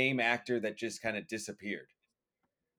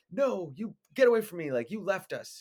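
upset pushing away; 8 semitones higher than usual. Retold or imitated. Wife pushing husband away, upset.

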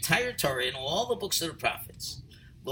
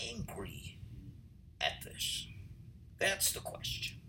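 much softer, whispered question; hushed question; 15 dB quieter than usual. Acted. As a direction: drop to a whisper to pose the puzzle. Posing the key question intimately.

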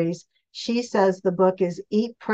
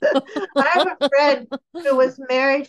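laughing through the words, pitched up; 6 semitones higher, 3 dB louder than usual. Real, not acted. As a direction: still giggling as she starts the story. Laughing after the itch remark.

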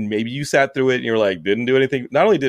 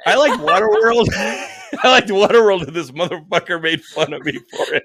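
mock whiny friend; 7 semitones higher, 4 dB louder than usual.

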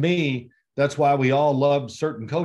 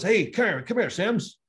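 higher-pitched imitation of a coach's summons; coach calling over; 8 semitones higher than usual. Coach beckons his player before practice, imitated calling over. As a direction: call a player over, as in a retold story.

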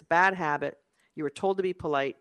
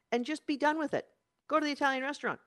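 higher-pitched, decisive declaration; firm declaration; 9 semitones higher, faster than usual. Shown as a demonstration. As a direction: claim it and declare it. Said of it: "Claim it and declare it".

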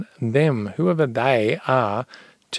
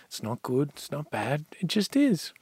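calm farmer; 7 dB quieter than usual. Retold or imitated. Farmer repeats calm mantra.

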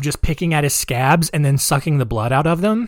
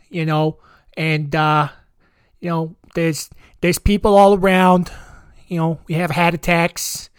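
hammed-up mock Boston accent; 2 semitones higher than usual. Mocking locals rambling about cars and chowder, broad Boston accent. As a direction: drop every r like a caricature Bostonian. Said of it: "the Boston accent is beyond dumber sounding".

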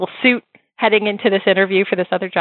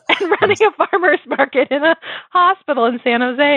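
higher, sheepish confession; imitating her younger self; 8 semitones higher than usual. [name] telling Deborah about the shack, higher wry voice. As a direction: list it with a wry pitched-up voice. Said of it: "And I was like".

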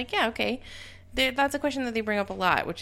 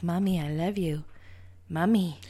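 put-on creepy little girl. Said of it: "creepy little girl being like".

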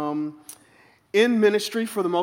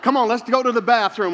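mock prophetic counsel; 4 semitones higher than usual. A boy counselling Barbie and Ken, mildly prophetic and laughing. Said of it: "I sense the Lord saying".

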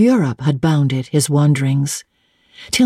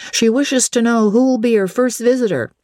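high-pitched servant voice; higher voice for a servant reporting; footman announcing; 8 semitones higher than usual. A narrator's reading. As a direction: relay the message as a footman.

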